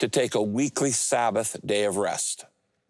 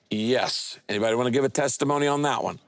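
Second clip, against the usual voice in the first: normal talking voice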